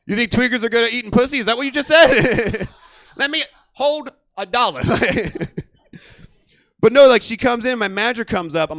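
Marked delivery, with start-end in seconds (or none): 3.2-5.7 higher mushy beg through laughter; laughing tweaker voice. Retold or imitated. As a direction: beg for a dollar while cracking up. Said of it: "all tweakers talk like that".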